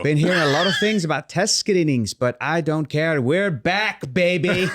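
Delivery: half-committed Northern Irish accent; bad Irish accent, breaking into a laugh at the end; terrible Irish accent. Shown as a demonstration. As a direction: read it out in a shaky-footed Irish accent. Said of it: "Sorry, that's terrible".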